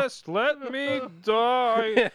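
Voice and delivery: very slow, high melodramatic moan; mock dying wail. Host joining in sick Carl's moans, melodramatic wail. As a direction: draw out a hammed-up deathbed plea.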